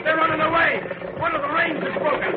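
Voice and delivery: high alarmed call; alarmed cry. Acted. Boss crying out that the team is running away, high alarm. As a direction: raise the alarm as the team bolts. Runaway horses.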